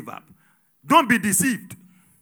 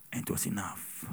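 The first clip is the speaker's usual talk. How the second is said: hushed whisper; 11 semitones lower, 15 dB quieter than usual. Acted. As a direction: drop to a breathy secret. Quiet hushed moment in sermon.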